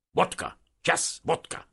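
high-pitched abrupt soldier's demand; Russian soldier demanding. A narrator's reading. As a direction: demand it in short, high bursts. (orosz katona követelőző, magas hangon)

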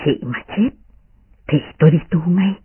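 put-on subdued young man making a vow; young master's vow. A narrator's reading. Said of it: "Bảo Ngọc nói".

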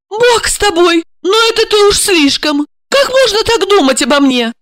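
high indignant protest; high shocked protest to her accusing friend. Acted. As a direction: push back, hurt and shocked. Olga recoils, protests.

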